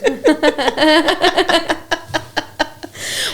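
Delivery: high, breaking peals of laughter; long laughing fit. Real, not acted. Friends cracking up at their own joke, helpless laughter.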